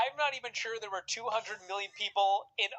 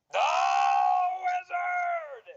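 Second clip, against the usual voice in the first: slow build, then a high loud shout; big reveal shout; 13 semitones higher, 6 dB louder, much slower than usual. Pretend. Hosts hyping up the Wizard as the answer, shouting. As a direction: land the name like a ring announcer. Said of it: "reveals the punchline".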